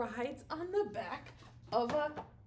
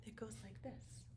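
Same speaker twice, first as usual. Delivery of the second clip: whispering; 17 dB quieter than usual. Shown as a demonstration. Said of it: "unvoiced whisper".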